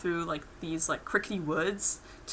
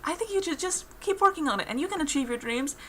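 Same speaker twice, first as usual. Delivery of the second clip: lightly voiced Pim; 9 semitones higher than usual. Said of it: "that's what Pim says".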